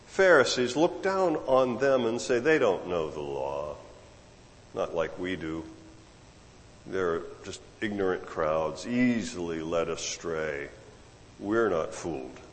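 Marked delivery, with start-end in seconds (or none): 2.4-12.4 lofty sneer. Retold or imitated. Arrogant Pharisees look down.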